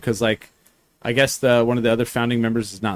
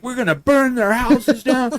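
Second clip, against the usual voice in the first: much higher, slow mock outrage; angry townsfolk; 10 semitones higher, slower than usual. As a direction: ham up the outraged mob.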